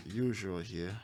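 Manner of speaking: ordinary talk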